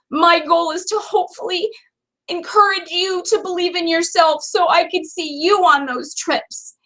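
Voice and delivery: holding back tears while encouraging; emotional. Real, not acted. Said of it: "I'm getting all emotional again".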